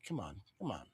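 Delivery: low, soft groan of come on under the breath; quietly willing the team, "Come on" barely voiced; muttered come on. Real, not acted. Streamer pleading with team, soft muttered urging.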